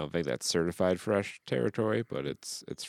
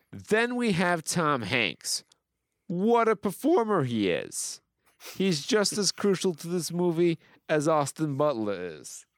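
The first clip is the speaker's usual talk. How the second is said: gushing review read-out; 10 semitones higher, 3 dB louder than usual. Acted. Host reading praise of Tom Hanks, hammed-up gushing. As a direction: declaim it with overblown wonder.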